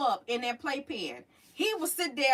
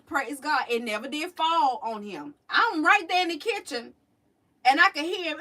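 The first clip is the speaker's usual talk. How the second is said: usual speaking voice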